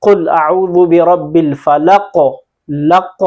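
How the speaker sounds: फलक ending on a bouncing, echoed "q"; qalqalah. Shown as a demonstration. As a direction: recite कुल अऊज़ु carefully, rebounding the last letter of फलक. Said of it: "कलकला हो रहा है".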